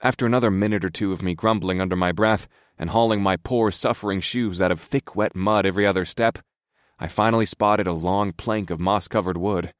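usual speaking voice

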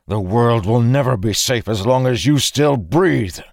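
heated, forceful verdict; furious threat. A narrator's reading. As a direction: hurl it at an enemy. Angry threat mid-fight.

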